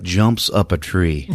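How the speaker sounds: ordinary talk, nothing marked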